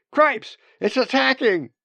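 loud, high cry of panic; alarmed shout. Acted. Man shouts as a bird grabs his sandwich, alarmed. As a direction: yell out in high-pitched alarm.

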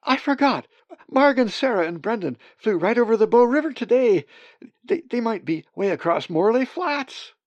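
high, loud, worried rush of words; Molly frantically telling Zack where the others flew; anxious outburst. Acted. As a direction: blurt out the fear for the others. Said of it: "Molly cried".

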